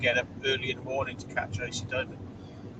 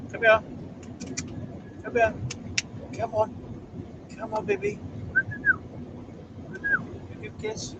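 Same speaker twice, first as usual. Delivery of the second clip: pet coaxing. Real, not acted. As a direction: call the dog over in a high, coaxing voice. Coaxing the dog for a kiss.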